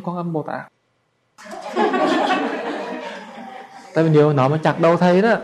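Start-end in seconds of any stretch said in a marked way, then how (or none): 1.4-3.9 a breathless unvoiced burst of laughter, high up; high airy laugh. Real, not acted. Monk laughing at pressuring the bodhisattva, high airy laugh. 3.9-5.4 louder, laughing through the words. Real, not acted. Monk joking about his own head, laughing.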